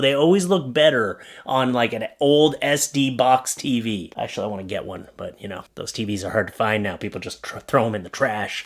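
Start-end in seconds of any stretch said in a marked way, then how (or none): none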